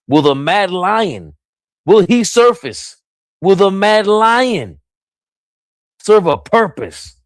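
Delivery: high, slow, drawn-out announcer build; dramatic hype call. Real, not acted. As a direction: ham it up like a ring announcer. Dramatic build calling for the Mad Lion.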